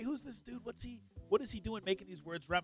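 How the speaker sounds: usual speaking voice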